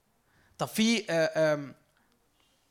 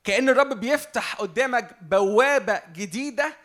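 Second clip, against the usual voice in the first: slow and louder, each phrase pressed home; slow fervent preaching; 3 semitones higher, 5 dB louder, slower than usual. Acted. Preacher to congregation about God's open gate, slow and louder. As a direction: proclaim it slowly like a new gate opening.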